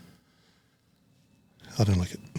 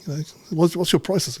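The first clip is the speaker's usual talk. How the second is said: customer asking prices; 6 semitones higher than usual. Retold or imitated. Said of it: "he goes".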